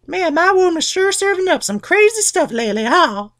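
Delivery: very high falsetto fake casual; Rhea feigning. Rhea innocently serving Cronus the rock, falsetto. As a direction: innocent chitchat cover. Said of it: "all like".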